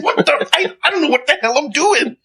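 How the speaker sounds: high, frantic mock panic at the gears; fumbling driver losing control, voice up high; panicked. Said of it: "I'm saying".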